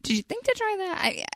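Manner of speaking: put-on high patronising tone, as to a child; condescending. To the laundry lady, mock patient and condescending. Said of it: "and I say".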